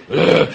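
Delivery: loud grunt of disgust at the smell; loud, revolted groan; disgusted ugh. Pretend. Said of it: "abres la tapa y dices".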